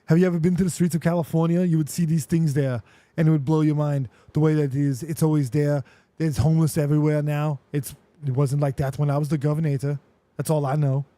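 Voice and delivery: put-on Austrian-accented former governor, low and forceful; Arnold Schwarzenegger. Host as the former governor on California's streets, low Austrian accent slipping Southern. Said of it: "why I got Southern at the end".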